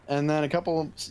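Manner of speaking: normal talking voice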